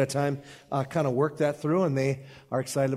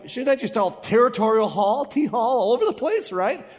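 higher and louder, hamming up a wild party; mock party excitement; 8 semitones higher, 5 dB louder than usual. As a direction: whoop it up like a reveller. Mock party, imagined reader.

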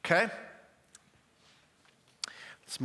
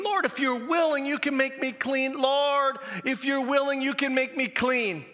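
pleading cry, louder and higher; desperate begging; 9 semitones higher, 3 dB louder than usual. Retold or imitated. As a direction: beg the Lord with all your heart, loud and high. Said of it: "He's begging him".

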